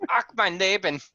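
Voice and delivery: a brief hammed-up German "Ach"; mock German. DM slips into a mock German accent. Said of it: "So that's, that's German".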